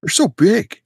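high, laid-back surfer dude; goofy beach bro showing off. Pretend. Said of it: "Why am I talking like a surfer dude?".

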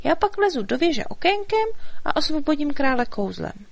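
a hint of high fairy voice; lightly voiced fairy. A narrator's reading. Fairy sharing her plan with the dragon, lightly high.

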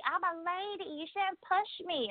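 high, soft mock-ladylike protest; annoying lady voice. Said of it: "her annoying ass voice".

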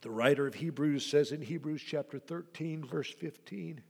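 ordinary delivery

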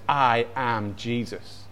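low, soft and slow; quiet, weighty self-revelation; voice of Jesus. Acted. The voice telling blinded Saul who it is, low and solemn. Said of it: "this voice says".

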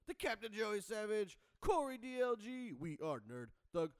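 booming ringmaster intro. Acted. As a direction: announce it like a circus ringmaster. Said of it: "such the, like, nineties late night guy".

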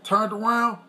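ordinary talk

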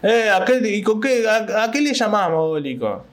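retold heckler yell. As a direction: yell it back like the angry heckler. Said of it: "va y me grita".

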